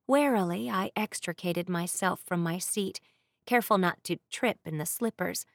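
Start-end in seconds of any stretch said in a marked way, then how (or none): none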